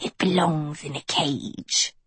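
put-on wheezy, scornful albino girl; wheezed. A narrator's reading. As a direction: wheeze it out with contempt. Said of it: "the albino wheezed".

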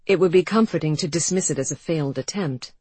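ordinary delivery, nothing marked

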